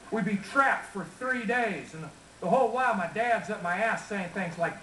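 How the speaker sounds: folksy Texas twang; Texas accent. Acted. Folksy rancher telling family stories to a crowd, Texas accent.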